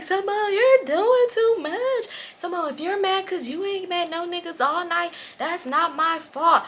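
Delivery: high, drawn-out mock whine; high-pitched complaining impression; whiny friend. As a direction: imitate a friend whining in protest. Voicing drunk friend's whining protest.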